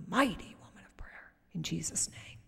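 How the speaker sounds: whispered blessing, soft and unvoiced. Real, not acted. Pastor whispering a prayer over her sister. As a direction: breathe the blessing quietly.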